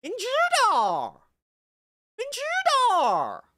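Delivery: exaggerated mainland Mandarin, swooping up loud and high; mainland Chinese accent. Shown as a demonstration. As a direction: ham up a mainland China twang. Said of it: "if I said the same thing coming from China".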